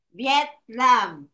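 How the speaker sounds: "Vietnam" broken into two separate beats; syllable by syllable. Shown as a demonstration. Teacher insisting to pupil it has two syllables, "Vietnam" split in two. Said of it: "Two syllables".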